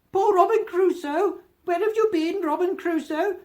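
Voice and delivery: very high, loud squawked call; parrot's call. Acted. Quoting the parrot's cry.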